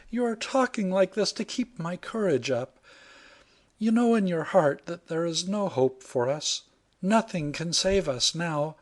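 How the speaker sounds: put-on high, intense young woman's voice; despairing heroine. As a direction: insist there is no hope, dreading.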